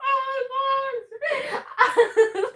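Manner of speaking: high, drawn-out laughing wail; helpless laughter. Real, not acted. Streamer sees the guess Valerie, helpless laughing wail. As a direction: crack up and stretch the words out high.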